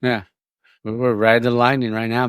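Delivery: normal talking voice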